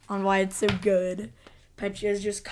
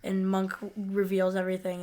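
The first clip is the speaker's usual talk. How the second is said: ordinary talk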